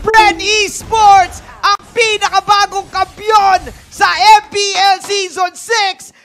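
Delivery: loud, slow, drawn-out winning call; championship call. Real, not acted. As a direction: crown the new champions at full voice. Caster calls the championship win.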